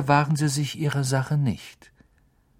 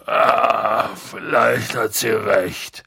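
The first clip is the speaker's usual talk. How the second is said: slow, groaning concession; woozy, reluctant admission; drunk Hagrid; 3 dB louder, much slower than usual. Acted. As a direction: sigh it out, thick with drink.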